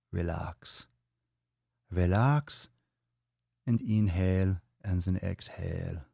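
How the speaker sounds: slow German meditation guide with v for w; lightly German guided meditation; German accent. Pretend. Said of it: "with a German accent".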